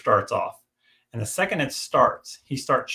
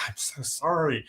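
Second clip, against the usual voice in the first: higher, softer imitation of an embarrassed apology; mortified apology; 4 semitones higher, 5 dB quieter than usual. Wife apologizing to strangers for her husband, mortified, imitated. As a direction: quote a mortified wife apologizing, small and high. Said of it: "The poor wife looked so mortified".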